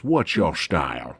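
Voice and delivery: low, slow movie-quote voice; movie thug's challenge. Retold or imitated. Guest quoting the scene on the boat, low slow challenge. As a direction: drag it out low and slow, like the film character. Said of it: "when the guy goes".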